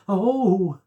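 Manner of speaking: high, loud startled gasp; frightened cry. A narrator's reading. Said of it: "she cried".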